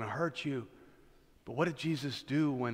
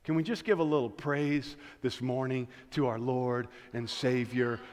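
usual speaking voice

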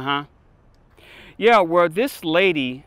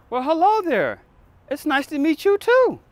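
mock-polite greeting; 12 semitones higher, 5 dB louder than usual.

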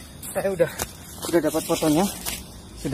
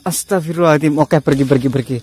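ordinary delivery

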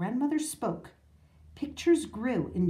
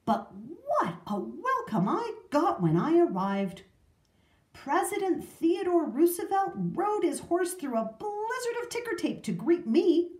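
high, swooping tall-tale voice; grand boasting; 9 semitones higher, 4 dB louder than usual. A narrator's reading. Grandfather telling of his welcome, grandly boastful. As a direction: brag with big, delighted exaggeration.

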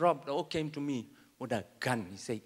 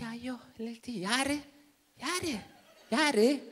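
put-on old auntie; 8 semitones higher, 4 dB quieter, much slower than usual. Grand auntie answering phone.

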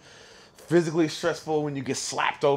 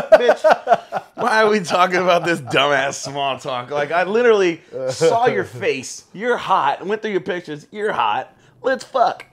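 loud, higher-pitched comic outburst; worked-up, impatient blurting; exasperated rant; 5 semitones higher, 8 dB louder, slower than usual. Acted. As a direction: cut through the small talk, loudly. Acted rant against small talk.

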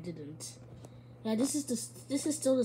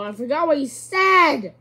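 alarmed outcry; 6 semitones higher, 15 dB louder than usual. Real, not acted. Panicked gamer forgets order.

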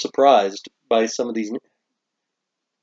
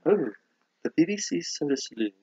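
softer put-on British accent; 3 semitones higher, 9 dB quieter than usual. Host takes up the BBC joke, British accent. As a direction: echo it as a polite Brit. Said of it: "how they would mock my British accent".

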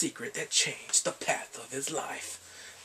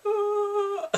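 mock wail; 6 dB louder than usual. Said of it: "he's watching in two thousand seven".

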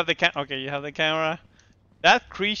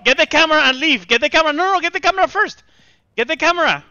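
panicked shouting; 5 semitones higher, 3 dB louder, much faster than usual. Real, not acted. Worm stuck on him, panicked orders.

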